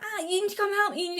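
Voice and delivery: put-on high, whiny little-kid plea from up a tree; imitating her child self. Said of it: "I'd be like".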